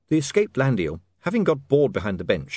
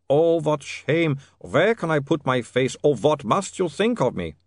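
put-on high accented lament; ashamed vampire; 5 semitones higher, 3 dB louder, slower than usual. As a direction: lament the shame with drawn-out cries. Otto ashamed after feeding.

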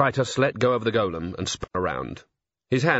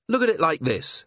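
higher, lightly voiced captain; reasoning watch captain; 6 semitones higher than usual. A narrator's reading.

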